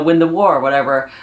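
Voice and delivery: ordinary delivery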